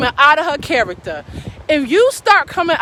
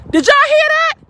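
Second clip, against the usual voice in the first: ordinary talk, nothing marked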